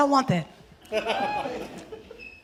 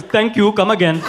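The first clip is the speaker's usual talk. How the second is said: Apu catchphrase. Retold or imitated. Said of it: "the worst thing a bully ever said to me was".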